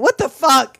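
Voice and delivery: mock-furious mom, loud and high; outraged mom. Mom finds the empty box, loud mock outrage. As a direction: yell it like an outraged parent. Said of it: "the mom who found it empty and went".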